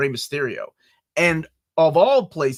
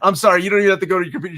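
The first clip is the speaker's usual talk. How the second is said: fast, louder, through laughter; laughing; 5 semitones higher, 7 dB louder, much faster than usual. Real, not acted. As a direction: rush it out while laughing.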